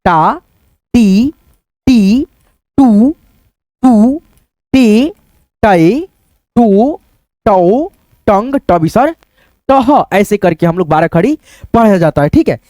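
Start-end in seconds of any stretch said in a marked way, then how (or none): 0.0-10.0 each syllable said alone with a short pause after it; syllable recitation. Shown as a demonstration. Teacher chanting the barakhadi to students, one syllable at a time. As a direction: recite slowly for children to repeat.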